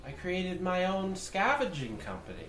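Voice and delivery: slow, rising to loud and high; interrogating noble. Acted. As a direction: press him for answers. GM holds the noble's voice.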